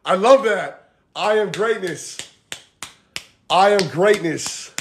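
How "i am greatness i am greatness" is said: slow, spaced-out affirmation; mantra. Shown as a demonstration. Coach giving the group a mantra, slow affirmation. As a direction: say it slowly for others to repeat. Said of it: "I want everyone to say this".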